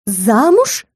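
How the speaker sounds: startled outcry, pitch shooting up; barked astonishment. A narrator's reading. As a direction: bark it out in disbelief. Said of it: "Гаркнули мы с графом".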